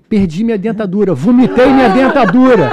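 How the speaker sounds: loud, rising retold outcry; imitated cry of dismay; alarmed exclamation. Retells passenger's alarmed confession.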